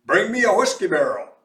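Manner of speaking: loud urgent call; cried out. A narrator's reading. Mose calls for a barrel, loud urgent shout. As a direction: shout the order up. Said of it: "he cried".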